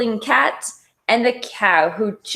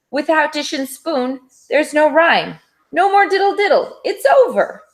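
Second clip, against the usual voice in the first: rising dramatic alarm; 7 semitones higher than usual. A narrator's reading. Cat's dramatic lament, rising pitch.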